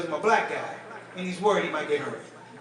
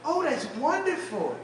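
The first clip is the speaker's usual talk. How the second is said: sweet lady voice; 5 semitones higher, 3 dB quieter, slower than usual. Retold or imitated. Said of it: "She goes".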